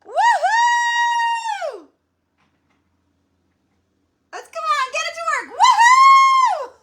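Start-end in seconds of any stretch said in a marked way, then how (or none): 0.0-1.9 high whooping cheer; excited whoop. Real, not acted. Celebrating challenge start. 5.6-6.7 high whooping cheer; excited whoop. Real, not acted. Celebrating challenge start.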